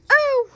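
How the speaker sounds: shrill pained ow; high yelp of pain. Acted. Toy yelps when flung by the fan. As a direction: yelp as you get hit.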